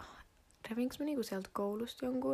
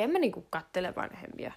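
ordinary talk, nothing marked

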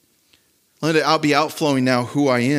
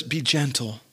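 soft gentle; 2 semitones lower, 6 dB quieter than usual. Shown as a demonstration. Preacher softly voicing Paul's command to the church. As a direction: lay "Be gentle" down softly, like a hush. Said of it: "But he says".

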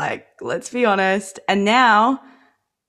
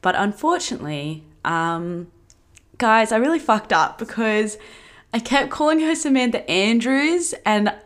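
usual speaking voice

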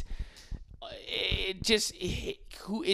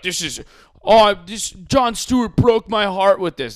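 mock heartbroken fan; 6 semitones higher, 8 dB louder than usual. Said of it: "So to be like".

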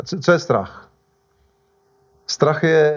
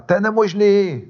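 mildly shouted taunt, pitched higher; mock shout; 5 semitones higher than usual. Waves crying out at Peter on the water, mock shout. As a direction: call it out like roaring waves, half-playfully. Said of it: "ty vlny křičely na".

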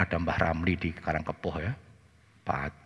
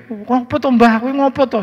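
menirukan anak yang panik, tinggi dan keras; tanya cemas dengan suara melonjak; bingung dan takut; 12 dB louder than usual. Retold or imitated. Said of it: "anaknya bingung, takutnya". (kebingungan, setengah panik)

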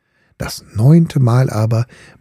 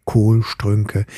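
ordinary delivery, nothing marked

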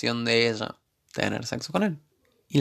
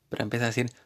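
ordinary delivery

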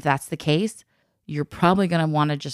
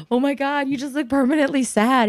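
teasing dad; 9 semitones higher than usual. Retold or imitated. Retelling husband teasing daughter.

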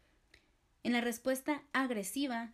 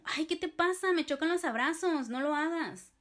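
high, loud, irritated snap; aggressive reply; 6 semitones higher, 4 dB louder than usual. Shown as a demonstration. To someone hugging you anyway, a loud annoyed snap. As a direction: push the hugger away with your voice. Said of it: "Una respuesta agresiva puede ser que respondas algo como".

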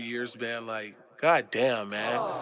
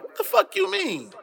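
outraged disbelief. Real, not acted. Outraged at people's reaction.

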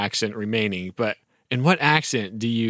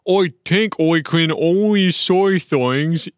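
slow, drawn-out oi vowels for every I; slow comic accent, oi for I; strange accent; 5 semitones higher, slower than usual. Acted. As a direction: read the girl's odd accent, slowly. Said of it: "her strange accent".

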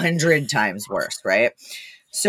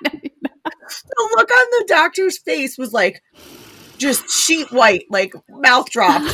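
laughing; 13 semitones higher, much slower than usual. Real, not acted. Laughing, slow.

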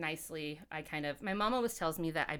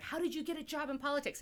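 mock eager poli-sci grad; 9 semitones higher, faster than usual. Mimicking job-hungry poli-sci majors.

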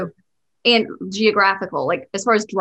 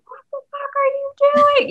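mock road rage; 4 dB louder, faster than usual. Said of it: "she'd be like".

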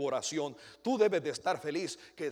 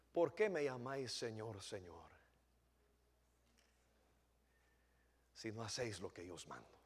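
very soft, much lower, near a hush; quiet rebuke; 8 semitones lower, 12 dB quieter than usual. Acted. Jesus asking his disciples why they call him Lord, hushed. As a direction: let the reproach sink in gently.

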